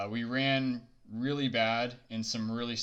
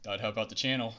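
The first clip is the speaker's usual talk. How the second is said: ordinary delivery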